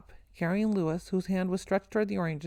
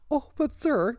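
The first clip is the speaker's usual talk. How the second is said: hurried interruption; 7 semitones higher, 3 dB louder, slower than usual. A narrator's reading. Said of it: "interrupted Sister Gabriel hurriedly".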